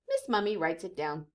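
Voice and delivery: ordinary delivery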